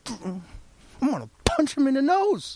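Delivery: high-pitched, worked-up threat; mock fuming, pitch jumping up; acted anger. Worker fuming about his boss, hammed-up angry threat. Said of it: "kept that attitude for months".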